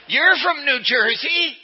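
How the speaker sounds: put-on "You're from New Jersey" high, loud, slow in a playful New Jersey accent; mock New Jersey accent. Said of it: "Your accent gives you away".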